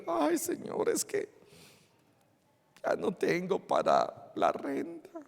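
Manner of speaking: very soft, whimpering complaint; acted weepy plea to God; mock tearful lament. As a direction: whimper it pitifully, barely audible. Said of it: "¿Por qué estás llorando?".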